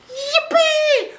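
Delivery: high, loud squeal of joy; gleeful cheer. A narrator's reading. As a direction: cheer like a kid at takeoff. Plane takes off, child cheers.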